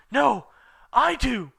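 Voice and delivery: high, loud insistent cry; Ebony shouting over the boys. Acted. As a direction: shout to be heard. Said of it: "I shouted".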